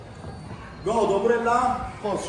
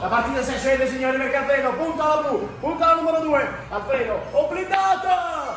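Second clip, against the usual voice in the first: excited play-by-play, higher, rising to the end; sportscaster voice; 5 semitones higher than usual. Pretend. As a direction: call the shot like a TV commentator. Mock sports commentary on the shot.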